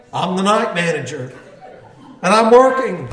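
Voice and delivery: ordinary delivery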